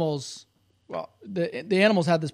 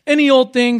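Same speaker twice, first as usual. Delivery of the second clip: dismissive; 8 semitones higher, 14 dB louder than usual. Acted. Big animals brushing off rabbit.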